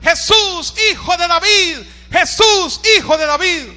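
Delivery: full-voiced acted beggar's call; blind man shouting. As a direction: cry out to Jesus so he cannot ignore you. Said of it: "aquel ciego gritaba".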